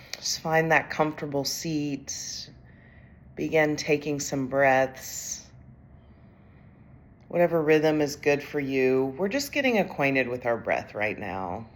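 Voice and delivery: calm, unhurried meditation voice with long pauses; guided meditation. Acted. Executive coach leads overwhelmed leaders through breathing, calm guided meditation. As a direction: guide them gently through each breath.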